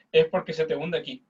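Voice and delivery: ordinary talk, nothing marked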